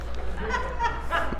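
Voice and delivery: high burst of real laughter at his own joke. Real, not acted. Preacher laughing at his parable's punchline, high real laugh.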